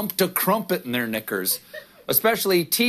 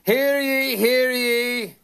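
town crier; 5 semitones higher than usual. Acted. Palace statement announced by a crier.

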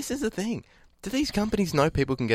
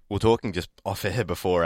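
ordinary delivery

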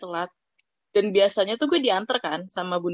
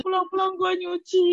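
mock wail; 9 semitones higher than usual. Teasing retelling of friend's fall.

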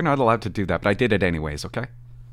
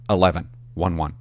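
normal talking voice

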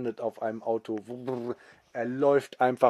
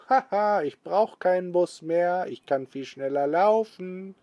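higher, louder gleeful exclaiming; delighted granny; 7 semitones higher, 3 dB louder than usual. Acted. Old grandma showing off that she can run again, gleeful. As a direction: crow with joy at your new speed. Grandma boasts to bus passengers.

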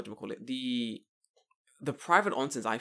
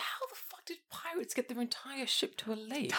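whisper; 10 semitones higher, 8 dB quieter than usual. Real, not acted. Incredulous whispered question.

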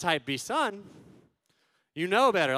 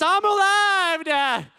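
high, loud, drawn-out cry; hammed-up kid shouting at full pitch; frantic yell; 12 semitones higher, 9 dB louder, much slower than usual. Dad imitates son instantly healed of paralysis, frantic shout. Son suddenly cured by fear of missing out.

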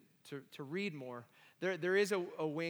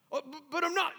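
much higher and louder, frantic denial; loud protest; 12 semitones higher, 7 dB louder than usual. Retold or imitated. Young [name] protesting to his mentor Harold, frantic denial. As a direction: refuse the idea outright, panicked.

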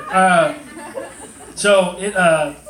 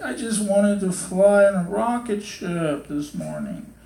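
slow, higher, drawn-out plaintive voice; imitated crying child; 4 semitones higher, slower than usual. Son explaining his rocket ship wish, imitated crying. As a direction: act a heartbroken little boy wailing gently. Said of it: "he's crying. And he's like".

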